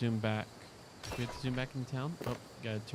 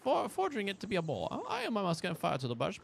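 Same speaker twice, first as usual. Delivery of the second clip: quick, higher read-aloud voice; 7 semitones higher, faster than usual. Acted. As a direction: read the journal entry briskly. Reads quest text aloud.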